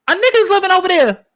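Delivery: mock loud exclamation. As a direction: blurt it out high and loud like a caricature. Exaggerated mock exclamation after apology.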